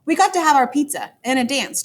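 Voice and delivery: ordinary delivery, nothing marked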